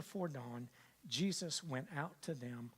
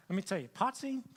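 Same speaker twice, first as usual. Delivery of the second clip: ordinary delivery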